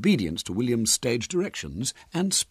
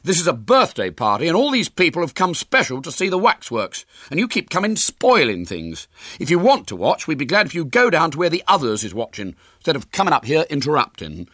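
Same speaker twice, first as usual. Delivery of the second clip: put-on high, loud boyish scolding; aggrieved, raised-voice complaint; indignant; 8 semitones higher, 6 dB louder than usual. A narrator's reading. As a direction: tell off the interrupters with outrage. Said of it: "he said indignantly".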